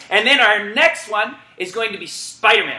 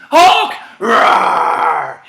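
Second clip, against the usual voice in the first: shout and roar; 3 semitones lower, 5 dB louder than usual. Real, not acted. Coach calling the Hulk command: loud call and roar. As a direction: bark then roar.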